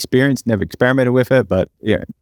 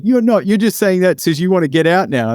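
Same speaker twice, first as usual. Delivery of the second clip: higher, indignant imitation of her; lightly voiced accusing girlfriend; mock girlfriend retort; 7 semitones higher than usual. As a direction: throw her accusation back in a raised voice.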